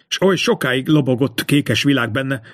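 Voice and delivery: plain reading voice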